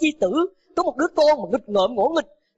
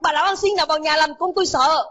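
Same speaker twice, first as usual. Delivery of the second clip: angry shout; 5 semitones higher than usual. A narrator's reading. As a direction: bark out, where is she. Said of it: "chạy từ trong nhà ra hét lên". (người cha chạy ra quát lớn)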